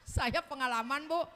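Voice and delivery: ordinary talk, nothing marked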